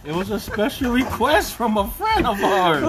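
laughing. Real, not acted. Answers while laughing.

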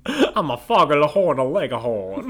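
blustery Southern rooster voice; Southern accent. Pretend. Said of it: "very southern".

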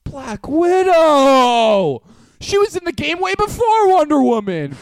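loud, high, drawn-out holler; emphatic shout. Real, not acted. Podcaster voting in a matchup game, emphatic shout. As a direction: cry out your pick with total conviction.

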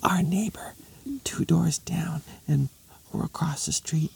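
conspiratorial stage whisper; hushed, secretive whisper. Acted. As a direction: whisper it into a big ear.

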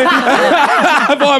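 normal talking voice

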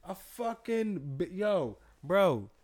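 exasperated. Real, not acted. Exasperated outburst.

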